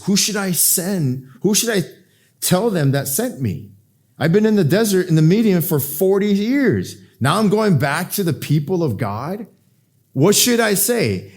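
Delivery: high-pitched, worked-up Moses pleading with God. Acted. Moses asking God who sent him, worked-up questioning. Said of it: "And here's Moses".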